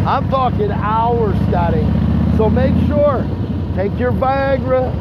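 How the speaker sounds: big declamatory trash talk; hyped promo. Acted. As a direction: work the crowd like a wrestling promo. High-energy promo addressed to Scotty.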